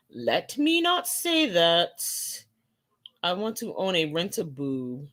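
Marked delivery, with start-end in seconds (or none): 0.1-2.0 higher, slowed and deliberate; slow emphasis. Real, not acted. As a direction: lean on not, slowly.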